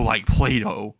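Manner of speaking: ordinary delivery, nothing marked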